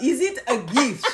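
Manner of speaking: slow mock French question, amused; French accent. Co-host quoting the film, slow mock French. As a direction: put on a French accent through giggles. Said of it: "to do the French accent".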